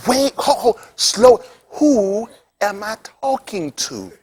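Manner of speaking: drawn-out, careful imitation on the phone; slow-talking preacher. Imitating the preacher answering a business call, slow talk. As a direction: mimic him, dragging out each word. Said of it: "He talked like that".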